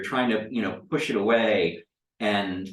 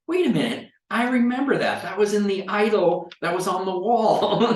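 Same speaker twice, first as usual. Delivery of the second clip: high-pitched mock disbelief; astonished recognition; 11 semitones higher, 4 dB louder than usual. Game master voicing players spotting looted items, astonished. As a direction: blurt it out high, as if suddenly recognizing it.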